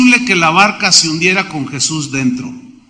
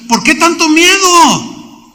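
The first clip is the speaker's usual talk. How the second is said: Jesus rebuking; 10 semitones higher, slower than usual. Retold or imitated. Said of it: "dándoles una tremenda lección".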